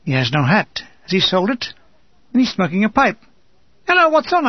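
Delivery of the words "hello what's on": high-pitched, cheery hail; bright hello, pitched well up; chirpy greeting. A narrator's reading. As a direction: greet an old mate in a lifted voice. Surprised greeting of an old comrade.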